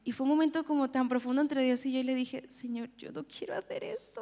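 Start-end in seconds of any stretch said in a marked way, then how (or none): 2.5-4.2 soft, reluctant plea; hushed, unwilling prayer; retold plea. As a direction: quietly beg to be excused. Acted plea to God, reluctant.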